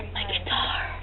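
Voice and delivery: hushed whisper. Real, not acted. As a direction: whisper it like a secret. Whispering about guitar.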